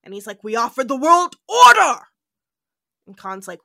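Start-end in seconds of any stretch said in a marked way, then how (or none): 0.4-2.0 loud, indignant proclamation; angry shout. Retold or imitated. Khan answering Kirk's interrogation, a loud indignant shout. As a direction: bellow it like a tyrant insulted. Khan's outburst in retold scene.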